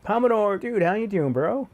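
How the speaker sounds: high, loud excited hello; excited greeting. Real, not acted. Greeting a chat member excitedly.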